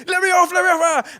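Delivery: very high, loud frantic yelling; man who's lost it. Retold or imitated. Trapped passenger running up and down, frantic screaming. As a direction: scream to be let out. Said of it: "the other guy has lost it".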